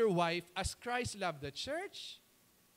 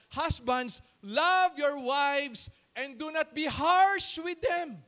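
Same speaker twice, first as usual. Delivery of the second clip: P=A; stressed Bible quote; 2 semitones higher, 7 dB louder, slower than usual. Shown as a demonstration. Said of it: "Please underline that word".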